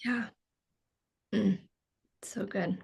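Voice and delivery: breathy whisper of approval. Real, not acted. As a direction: whisper it, almost to yourself. Moved reaction to guest's words.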